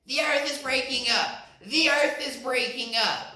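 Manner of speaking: high, loud frantic yell; high panicked rabbit yell. Acted. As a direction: yell it in a panic as you run.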